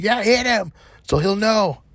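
drawn-out imitation of a little old lady; mock old lady. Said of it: "She's like".

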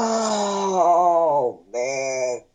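long drawn-out "oh" sinking down; dismayed groan. Retold or imitated. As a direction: groan it like bad news landing. Retold dismayed reaction.

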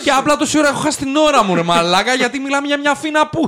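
high, very loud hammed-up rant; over-the-top shouted complaint; mock furious tirade. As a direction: yell in comic outrage at wasted time. Mock tirade about wasted time.

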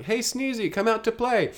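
put-on high-pitched kids calling a friend; high, singsong child's call; calling children. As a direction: imitate kids calling out to play. Orphan kids calling Sneezy.

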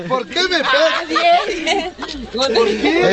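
high, slow laughing whine; mock whine. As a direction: whine it like a hurt kid. Mock whining after joke.

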